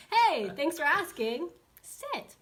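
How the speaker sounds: high, loud, drawn-out trainer voice; upbeat high-pitched dog-trainer talk; cheerful dog command. Shown as a demonstration. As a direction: grab the dog's attention, then cue it sweetly. Demonstrating how to redirect a dog.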